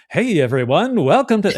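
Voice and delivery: high, swooping used-car-salesman pitch; speaker voice. Acted. Husband practising a speech for his wife, put-on salesman-like speaker voice. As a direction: overdo a showy public greeting. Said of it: "this is my speaker voice".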